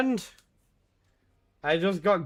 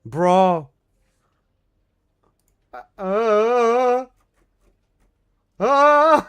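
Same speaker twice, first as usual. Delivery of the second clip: stunned yell; 5 semitones higher, 8 dB louder, much slower than usual. Real, not acted. Stunned by huge sub gift.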